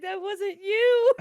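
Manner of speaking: very high, loud, drawn-out wail; mock wail. Telling Jason about the mix-up, high mock wail. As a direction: wail it, imitating your distressed self.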